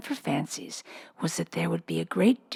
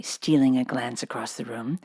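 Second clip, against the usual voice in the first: ordinary delivery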